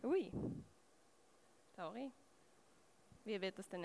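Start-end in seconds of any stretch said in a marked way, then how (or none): none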